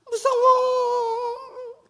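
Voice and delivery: very high, loud, drawn-out whimper; mock scared child. Child frightened by the dog whimpers to parent, high and drawn out. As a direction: wail it like a frightened little kid.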